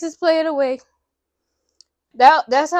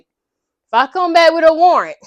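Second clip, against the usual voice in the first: loud, raised-pitch mock warning; retold annoyed cop; 5 semitones higher, 10 dB louder than usual. Annoyed cop warns difficult roommate, retold irritation. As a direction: warn them like a fed-up cop, imitated. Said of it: "she done annoyed the cop at this point".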